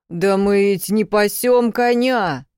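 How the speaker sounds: громко возражает старухе; растерянный парень оправдывается; оправдываясь, медленно. A narrator's reading. Констан оправдывается перед бабкой. (возражение, медленно и громко)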